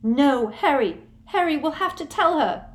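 high, loud pleading; mock desperate plea; desperate Hermione. A narrator's reading. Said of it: "Hermione feigning breakdown".

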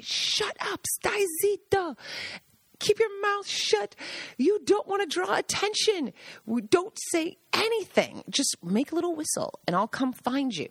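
put-on high, hushed-urgent old Italian guide scolding; Gaggi's voice. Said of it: "and he would say".

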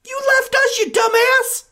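high tearful yell; crying shout. Acted. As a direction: scold through sobs. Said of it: "I'm gonna start crying".

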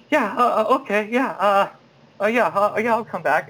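high, halting string of yeses; flustered agreement. Retold or imitated. Nervous actor accepts invitation to come back, flustered agreement. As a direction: stumble through a nervous acceptance. Flustered reply to callback offer.

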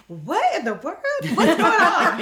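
high, loud comic disbelief, rising in pitch and level; incredulous bafflement. Retold or imitated. Student offered a stipend by heart mom, incredulous bafflement. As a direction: act out being utterly thrown by it.

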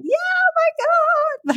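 very high, drawn-out squeal; re-enacted excited yell. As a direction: squeal it slowly in mock-screaming delight. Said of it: "I just remember yelling".

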